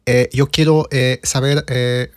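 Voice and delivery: lightly voiced, halting Spanish full of "eh"; a hint of a hesitant Spanish-speaker impression; Pacquiao speaking Spanish. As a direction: imitate someone groping for words in Spanish. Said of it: "I always imagined Pacquiao speaking Spanish like this".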